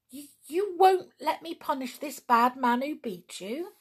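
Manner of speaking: high-pitched, incredulous question; astonished chimpanzee's imagined voice; great surprise. A narrator's reading. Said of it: "a look of the greatest surprise".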